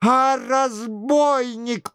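high, drawn-out indignant insult; angry through clenched teeth. A narrator's reading. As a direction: seethe as an outraged king. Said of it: "стиснув зубы от злости".

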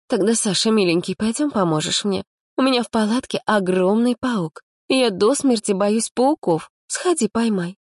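put-on high, sweetly pleading girl's voice; coaxing girl. A narrator's reading. Lera sweet-talking Sanya.

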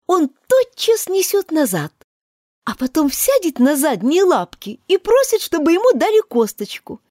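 put-on gently coax, a touch girlish; a hint of a polite little girl pleading; lightly voiced girl Alice.

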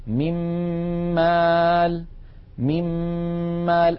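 من مال recited as "mim-maal" with a long hummed m; doubled meem. Shown as a demonstration. Teacher explaining the merging sign, doubled held m in من مال. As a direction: turn the n of من into a held m opening on مال. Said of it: "شددت الميم في كلمة مال".

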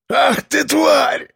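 high pained roar. Acted. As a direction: roar it in agony. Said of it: "заревел Борис".

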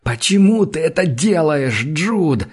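desperate cry; despairing howl. A narrator's reading. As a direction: wail the question at him. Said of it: "в отчаянии воет он".

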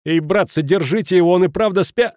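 high, loud alarmed call; shouting for help. A narrator's reading. Futaki begging the others to hold Schmidt back, shouting. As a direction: cry out and get cut off. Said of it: "закричал Футаки, но не успел закончить".

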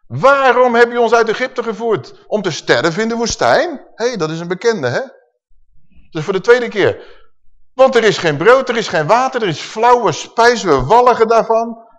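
loud, high, indignant outcry; complaining people. Acted. The grumbling people complaining to Moses, loud and indignant. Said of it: "Het volk sprak tegen Mozes".

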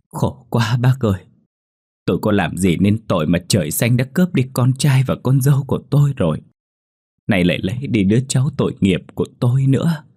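a mournful, tearful plea to heaven; grieving old woman. Acted. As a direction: cry out the loss of her grandchild. Grieving grandmother's lament.

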